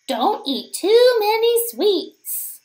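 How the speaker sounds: plain reading voice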